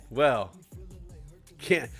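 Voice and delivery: ordinary talk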